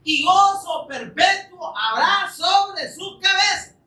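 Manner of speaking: alto, lento y jubiloso, como quien anuncia una victoria; proclámalo a pleno pulmón; proclamación exultante. Acted. Cita profética proclamada. (cita exultante proclamada en voz alta)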